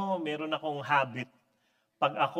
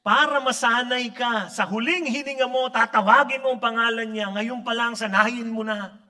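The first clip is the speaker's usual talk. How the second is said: louder preaching, building conviction; 6 semitones higher, 8 dB louder than usual. Acted. Preacher urging the crowd to practice calling Jesus, fervent. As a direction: drive it home to the congregation.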